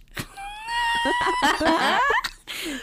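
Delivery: shrieking high laughter. Real, not acted. Cracking up.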